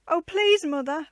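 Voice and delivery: high, loud eager plea; child bursting out pleading to mother. A narrator's reading. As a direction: blurt it out begging. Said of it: "burst out Joan".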